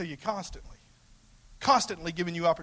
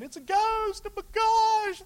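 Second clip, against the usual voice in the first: mock fearful cry; 3 dB louder, slower than usual. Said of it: "They cried out in fear".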